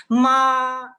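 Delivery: "ma" high, loud, long and level; sustained high flat tone on "ma"; first tone. Shown as a demonstration. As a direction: hold "ma" steady at the top of the voice. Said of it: "este es el primer tono".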